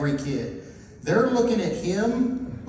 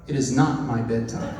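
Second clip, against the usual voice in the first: imitating a deadpan child, low-pitched; 7 semitones lower than usual. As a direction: state it low and straight, like little Lord Vader. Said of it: "deadpan look on his face".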